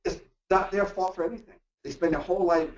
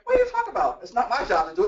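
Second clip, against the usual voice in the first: much higher, indignant pushback; defensive retort; 7 semitones higher, 3 dB louder, faster than usual. Retold or imitated. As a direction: snap back like it's not your fault. Acting defensive reply in argument.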